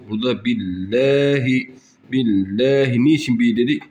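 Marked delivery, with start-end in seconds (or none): 0.4-3.0 billahi with a light thin l, lahi near læhi; thin lam. Shown as a demonstration. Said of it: "ince okuduk". (billahi ince okunuşla)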